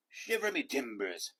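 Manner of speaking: gruff pirate exclamation. A narrator's reading. Head pirate reacting to the boy. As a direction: growl it like an old sea dog. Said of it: "the pirate said".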